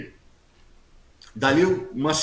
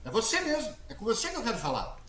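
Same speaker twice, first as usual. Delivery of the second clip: very high, softer mock pastor voice; pastora insistindo; 9 semitones higher, 6 dB quieter than usual. Pastor insists he come forward. (imitando a pastora, agudo e insistente)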